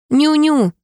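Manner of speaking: high, teasing lisped mimicry; mocking mimic. A narrator's reading. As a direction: parrot him back mockingly. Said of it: "передразнил он".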